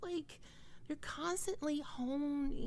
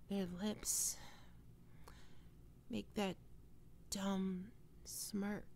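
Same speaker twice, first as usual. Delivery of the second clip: quiet, slow, lingering thoughts; soft musing; 3 semitones lower, 6 dB quieter than usual. Acted. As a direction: dwell on their face half-dreamily. Musing alone about roommate.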